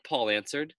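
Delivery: plain reading voice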